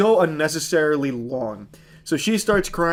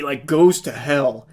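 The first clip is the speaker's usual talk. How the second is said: usual speaking voice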